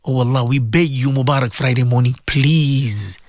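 low, earnest plea; humble begging. Real, not acted. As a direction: drop low and beg God sincerely. Begging Allah as the prayer subsides.

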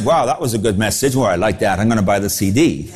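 a hint of a satisfied congregant; mock approval. Preacher mocking a pleased sermon listener, lightly voiced. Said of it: "Do you think they said".

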